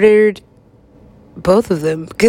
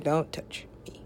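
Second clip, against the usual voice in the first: unvoiced mock-whisper of the girlfriend; 5 semitones lower, 14 dB quieter than usual. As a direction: hiss it under your breath. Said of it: "Only she said".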